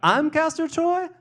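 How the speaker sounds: very high, loud questioning voice; bewildered Sandy. Acted. Sweetheart doubting who she is, high squeal. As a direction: squeal it in disbelief.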